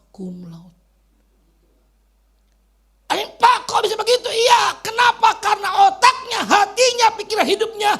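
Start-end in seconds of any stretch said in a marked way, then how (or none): 3.1-7.9 very high, loud sermon shout; full-force preaching. Real, not acted. Preacher answering the congregation's question, full-force shouted preaching. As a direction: preach it at the top of the voice.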